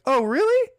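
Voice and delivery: high, challenging "really"; haughty mock queen. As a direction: toss it back like a miffed queen. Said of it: "She's like".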